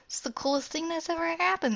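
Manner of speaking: high, bright-eyed squeal of delight; thrilled. Acted. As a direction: gush about the coolest thing ever. Daughter thrilled aliens are staying.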